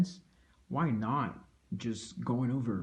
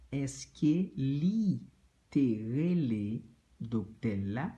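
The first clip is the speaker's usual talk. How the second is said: slow model; 2 semitones higher than usual. Shown as a demonstration. Teacher slows the question start for learners. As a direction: stretch the opening slowly. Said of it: "teaching pace".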